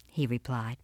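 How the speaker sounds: plain reading voice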